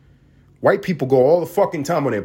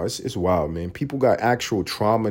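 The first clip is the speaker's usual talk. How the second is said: ordinary talk, nothing marked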